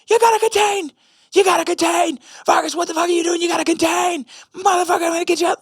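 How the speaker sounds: very high, strained imitation of a yelling coach; screaming coach. Coach berating a young linebacker, high mock scream. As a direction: mimic a coach screaming from the sideline. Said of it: "screaming at the top of his lungs".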